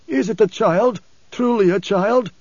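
high-pitched, insistent blind man; questioning preacher. A narrator's reading. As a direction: press the guide for confirmation. Preacher urgently questions his guide.

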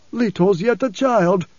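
high, slow objection; protesting preacher. A narrator's reading. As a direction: protest as the old blind preacher. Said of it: "the preacher protested".

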